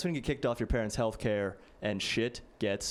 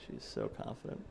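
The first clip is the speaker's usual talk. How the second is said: deadpan aside; 14 semitones lower, 10 dB quieter than usual. Shown as a demonstration. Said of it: "deadpan callback, barely audible".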